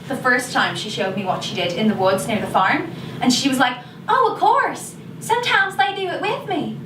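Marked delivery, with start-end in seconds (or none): 4.1-6.8 put-on much higher-pitched child's reply; mimicked little girl, high and matter-of-fact; imitated young girl. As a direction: voice a ten-year-old, pitched well up. Imitates ten-year-old Rachel's breezy reply.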